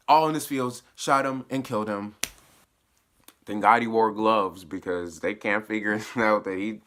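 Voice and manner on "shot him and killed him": normal talking voice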